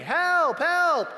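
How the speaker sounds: high, loud yell of help, help; reenacted panicked cry for help; retold scream for help. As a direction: shout for help like the dog is right behind you. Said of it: "And I started screaming".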